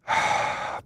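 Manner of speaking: deep sigh. Shown as a demonstration. Pausing to breathe, be patient.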